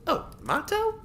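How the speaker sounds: put-on very high, surprised girl voice; surprised question. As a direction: caught off guard. Tori surprised.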